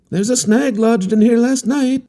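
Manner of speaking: put-on a young man shouting a warning; cried warning. A narrator's reading. Harlequin calling a warning to the captain, high and urgent. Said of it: "he cried".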